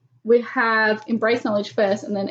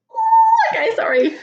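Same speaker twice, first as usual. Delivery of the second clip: high squeal turning into laughter; nervous laughing shriek; 5 dB louder than usual. Real, not acted. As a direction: laugh off the fright. Recovering from spider scare.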